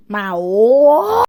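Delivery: loud call. Acted. Kid voicing toy battle.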